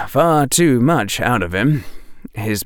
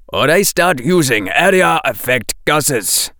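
firm, hard threat; harder voice; 5 semitones higher than usual. A narrator's reading. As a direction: make it a hard warning. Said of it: "making his voice harder".

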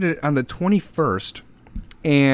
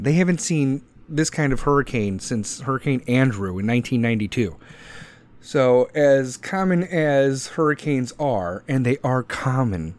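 ordinary delivery

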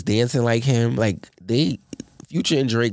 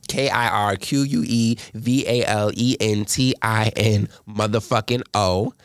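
spelled out slowly; slower than usual. Shown as a demonstration. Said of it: "follow me on Instagram".

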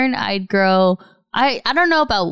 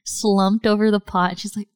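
ordinary delivery

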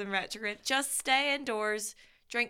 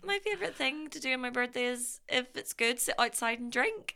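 ordinary delivery

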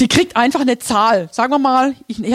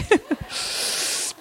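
soft breathy laugh; quiet, airy chuckle to yourself; 5 semitones higher, 8 dB quieter than usual. Real, not acted. Laughs after mock invitation. (leises Lachen)

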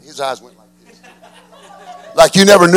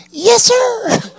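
squeaky, much higher, timid reply; meek yes sir. Retold or imitated. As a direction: shrink like a scolded boy. Meek reply to angry preacher.

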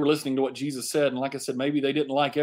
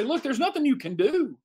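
much higher, throwing up hands; exasperated giving up; 10 semitones higher than usual. Retold or imitated. Pharisees give up on stopping Jesus, much higher and exasperated. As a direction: concede defeat in exasperation. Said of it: "throw up their hands and say".

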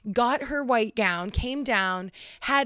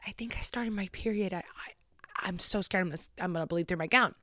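scared hushed whisper; whispering fear of bleeding through; panicked whisper; 2 semitones lower, 6 dB quieter, faster than usual. Retold or imitated.

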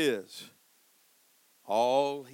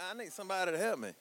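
fast call-out; 6 semitones higher, much faster than usual. Real, not acted. Preacher calls out to congregation.

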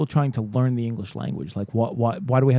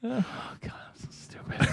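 whispered self-scolding; whispering to himself; 10 semitones higher, 12 dB quieter than usual. Real, not acted. Caught out by his own mix-up, whispering. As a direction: breathe it out in shame. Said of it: "embarrassed after the beefcake mix-up".

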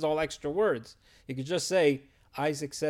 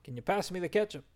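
casual mock son; 5 semitones higher, 5 dB louder than usual. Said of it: "He doesn't say".

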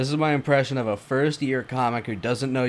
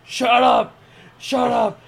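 Kramer impression; 10 semitones higher, slower than usual. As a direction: bark it like Kramer losing it on stage. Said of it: "recite the Kramer thing".

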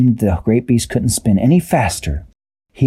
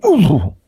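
hurt ooh; 7 semitones higher than usual. A narrator's reading.

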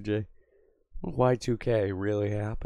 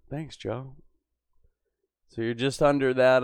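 ordinary talk

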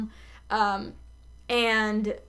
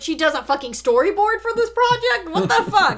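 much louder, pitched way up in disbelief; incredulous outburst; 10 semitones higher, 9 dB louder than usual. Real, not acted. Host interrupts their recap at the storyboard detail, loudly incredulous. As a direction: burst out high and loud, flabbergasted. Mock outrage at plot detail.

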